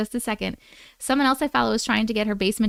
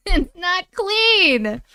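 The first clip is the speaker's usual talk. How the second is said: high, very drawn-out disbelief; exasperated protest; 7 semitones higher, much slower than usual. Real, not acted. Streamer disputing crew's claim of clean kitchen, exasperated. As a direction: stretch it out high in laughing exasperation.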